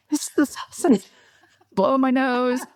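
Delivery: high, laughing fake sob excuse; half-laughing, playing at crying over the salsa; mock crying through laughter. Retelling crying at lunch, laughing high through a mock sob. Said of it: "pretending I'm crying because of the salsa".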